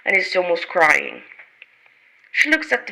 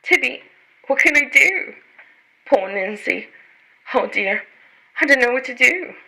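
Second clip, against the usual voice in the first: worried; 5 semitones higher, 3 dB louder than usual. Acted. Jane frets over missing Nancy.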